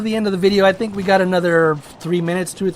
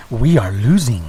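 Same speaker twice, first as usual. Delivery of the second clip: much lower, calm voice; calm announcer lady; 11 semitones lower than usual. Retold or imitated. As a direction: imitate the unruffled game lady, pitched way down. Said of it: "she says it so calmly".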